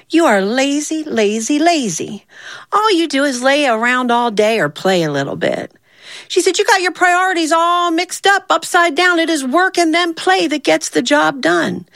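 high-pitched, exasperated telling-off; insistent, high lecture; scolding. Acted. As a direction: scold a lazy friend, pitched well up. Zia scolds lazy Yo Hoppy.

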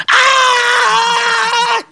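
long high-pitched scream; mock scream. Devil swung around the shop by a hammer, screaming. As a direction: scream "aah" on one held note.

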